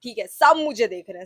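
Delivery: ordinary talk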